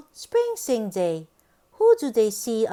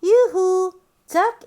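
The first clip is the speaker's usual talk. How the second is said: high, louder sing-song yoo-hoo; calling out; 6 semitones higher, 6 dB louder than usual. Acted. Storyteller calling hello to the ducks. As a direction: call across the pond.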